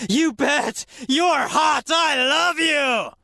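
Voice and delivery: high, loud shout of delight; excited yell. Acted. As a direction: yell it out, stretching the last words. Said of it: "Of course, she yelled".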